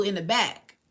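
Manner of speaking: usual speaking voice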